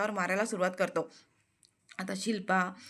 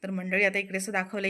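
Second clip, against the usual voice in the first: ordinary delivery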